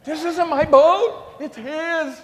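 blaming the friend in a frantic yell; panicked yell. Pretend. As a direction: shout your innocence in panic. Imagined panicked denial to police.

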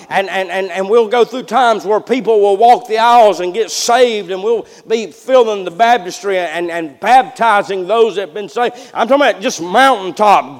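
ordinary delivery

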